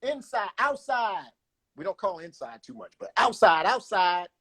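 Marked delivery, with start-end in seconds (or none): 0.0-1.3 high, drawn-out skate call. Shown as a demonstration. Skater calling out a side to others on the floor, drawn-out call. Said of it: "And then we call it out". 3.2-4.3 higher-pitched skate call, falling at the end. Shown as a demonstration. Skater calling out a side to others, raised-pitch call. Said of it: "And then we call it out".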